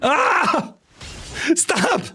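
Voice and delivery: high, panicky yelps; panicked shrieks. Real, not acted. Streamer panicking at a thousand redeems raining down. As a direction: squeal at the overwhelming flood.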